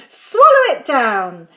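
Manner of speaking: loud, urging order; crying out. A narrator's reading. George urging Grandma to swallow the medicine, crying out. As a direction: call out the order with force. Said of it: "cried George".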